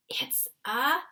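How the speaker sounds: thrilled whisper. A narrator's reading. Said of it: "he whispered with a thrill in his voice".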